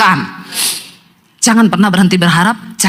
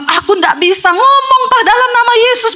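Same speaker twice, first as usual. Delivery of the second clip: struggling possessed husband. Retold or imitated. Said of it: "suaminya bilang".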